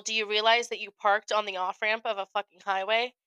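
normal talking voice

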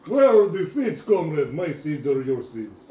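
mock Russian comrade hamster. Hamster addressing a comrade about seeds, mock Russian accent. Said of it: "They're actually Russians".

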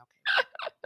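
very high, tiny mock okay; high comic squeak of okay; squeaky okay. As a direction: squeak it out sheepishly. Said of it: "I was like".

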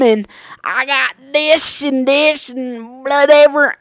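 high, loud Southern drawl; Southern accent. Retold or imitated. Toothless old lady griping to a store worker, loud Southern accent. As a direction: put on an indignant Southern twang. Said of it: "I don't know why I went Southern".

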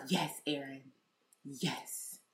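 softly congratulating herself under her breath; a hushed, proud little yes; quiet self-cheer. Retold or imitated. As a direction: whisper a private victory to yourself.

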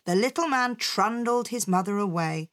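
plain reading voice

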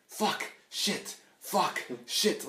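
put-on high, loud little-kid chant; squeaky alternating mock yelps; imitating cursing toddler. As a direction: parrot swear words like an excited toddler. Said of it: "runs around the house going".

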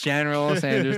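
General punched high and loud; stress on General. Shown as a demonstration. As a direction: hit the word General clearly. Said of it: "not Colonel".

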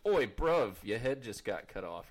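put-on light British accent, a quick cockney jab; mock British lad, only lightly voiced. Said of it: "funny in a British accent".

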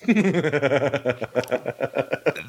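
soft, low trailing chuckle; long laugh. Real, not acted. Host on his hour-long commute, soft rueful laugh. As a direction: chuckle on and on, softly.

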